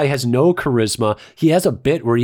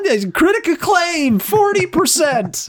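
sarcastic; 14 semitones higher than usual. Real, not acted. Sarcastic jab at critics' rating.

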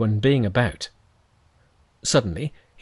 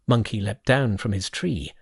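plain reading voice

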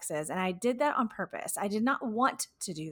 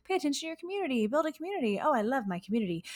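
high, fast, mock-gushing voice; mocking gushy influencer; 6 semitones higher, faster than usual. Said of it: "If you're like".

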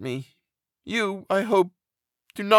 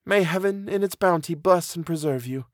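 tender farewell in a raised female character voice; high, earnest goodbye, softening at the end; half-suppressed emotion; 8 semitones higher than usual. A narrator's reading. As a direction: hold back the tears while blessing a friend. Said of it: "in a voice of half-suppressed emotion".

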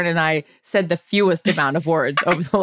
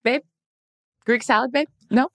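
higher, oblivious casual asking; imitating Jarrett; 5 semitones higher than usual. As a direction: mimic him unaware of the fire, pitched up. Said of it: "the whole time's like".

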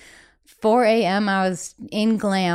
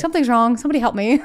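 higher, louder hammed-up call for help with a laugh; mock cry for help; 4 semitones higher, 3 dB louder, faster than usual. Joking about screaming for help, mock laughing call. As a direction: play-act yelling for rescue, half laughing. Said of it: "biggest fan of screaming for help".

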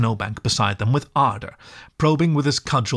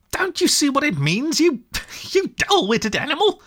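much higher, exasperated cry with a laugh; impatient. A narrator's reading. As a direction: Rat losing patience with the mole, high and exasperated. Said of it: "cried the rat impatiently".

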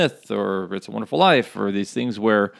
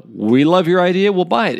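enthusiastic investor; 7 semitones higher, 5 dB louder than usual. Retold or imitated. Quoting the sharks' happy ending.

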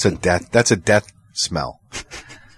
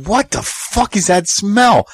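incredulous disgust; 9 semitones higher, slower than usual. Retold or imitated. Retold disgust in the truck.

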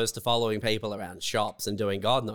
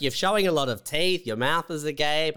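usual speaking voice

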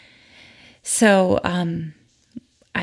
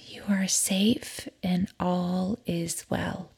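slow soothing whisper; 7 dB quieter, slower than usual. Acted. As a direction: breathe the words softly. Reassurance during breathing pause.

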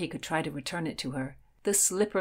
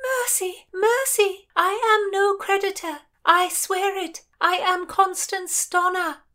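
very high, pleading cry; desperate plea; 13 semitones higher, 5 dB louder than usual. A narrator's reading. Heroine pleads with ladies threatening cannon, high urgent cry. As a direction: cry out for mercy across the water.

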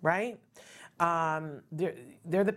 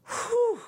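whew of relief; 4 dB louder than usual. Real, not acted. Exclaiming celebration.

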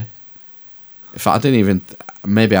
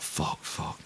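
whispered curse; 17 dB quieter than usual. Retold or imitated. Panicked thought when boss arrives.

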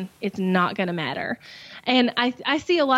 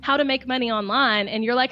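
usual speaking voice

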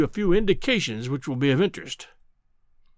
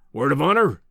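demanding professor; 4 dB louder than usual. Acted. Professor testing the visitor's promise, firmly demanding. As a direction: press for a solemn pledge.

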